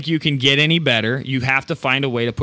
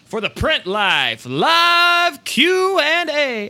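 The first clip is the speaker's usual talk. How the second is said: slow, drawn-out show announcer calling out, much higher on the end; 13 semitones higher, 3 dB louder, much slower than usual. Acted. Host announcing the next show segment to viewers in a slow, higher announcer call. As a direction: hype the segment title like a stadium announcer.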